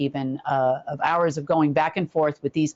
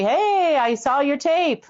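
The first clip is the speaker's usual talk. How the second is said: mock phone greeting; 9 semitones higher, slower than usual. Acting out the caller's phone greeting.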